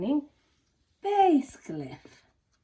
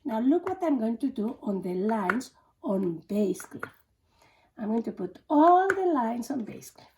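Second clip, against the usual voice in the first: usual speaking voice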